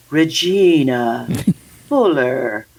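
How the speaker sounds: low, drawn-out pastor impression. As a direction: stretch the name out slowly, mock-solemn.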